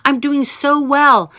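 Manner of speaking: bragging friend. Retold or imitated. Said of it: "somebody is saying".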